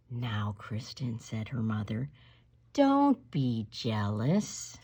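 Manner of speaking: slow, low, soft scolding; low patient don't be jealous; calm mother. Acted. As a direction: soothe a jealous child slowly.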